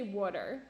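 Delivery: "water" said as WOOD-er; South Jersey pronunciation. Retold or imitated. Showing how South Jersey friends say it, "water" as WOOD-er. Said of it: "They say water".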